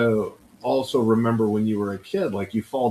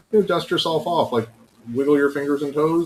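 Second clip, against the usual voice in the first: higher, chirpy coaching voice; lightly acted encouraging coach; upbeat coach to kid; 6 semitones higher than usual. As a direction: breezily talk a fallen kid back up. Coach talking a fallen kid up.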